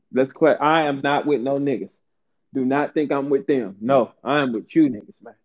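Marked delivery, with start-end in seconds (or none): none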